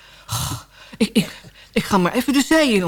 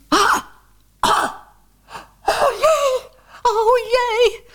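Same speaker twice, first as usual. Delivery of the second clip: high yelps of dismay at the mirror; much higher shocked cries with a laugh; shocked cry; 12 semitones higher, much slower than usual. Acted. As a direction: shriek at your sunburnt face. Sees sunburn in mirror.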